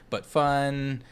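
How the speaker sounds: ordinary talk, nothing marked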